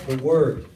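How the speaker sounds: "word" punched loud and low; stress on word. Real, not acted. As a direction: hit "word" hard. Congregational prompt.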